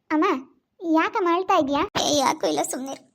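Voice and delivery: usual speaking voice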